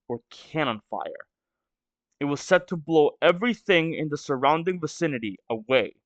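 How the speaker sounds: normal talking voice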